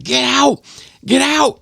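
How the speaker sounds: very high mock yell; drunk mother's yell. Drunk mother coming down the steps, high mock yell. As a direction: imitate a mother yelling to clear the house. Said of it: "So the drunk mother comes downstairs".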